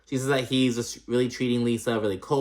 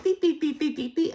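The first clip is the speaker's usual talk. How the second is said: imitated beeps. Recapping the fight, she mimics the bleeps way up high. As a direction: mimic the censor bleeps going off. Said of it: "it was like".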